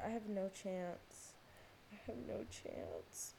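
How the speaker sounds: sighing it to yourself; resigned repeat. Real, not acted. Repeated, slower and quieter.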